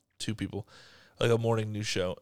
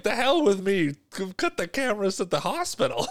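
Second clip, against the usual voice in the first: excited imitation; 8 semitones higher than usual. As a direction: blurt it out like an eager new dad. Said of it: "And he's just like".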